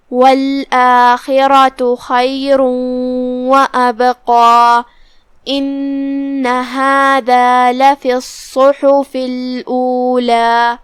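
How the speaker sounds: slow melodic tajweed chant; slow melodic Quran recitation; Quranic recitation. Shown as a demonstration. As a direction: recite slowly with drawn-out vowels. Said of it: "teacher recites the verses".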